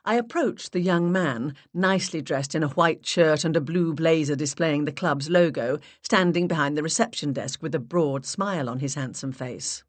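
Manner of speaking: plain reading voice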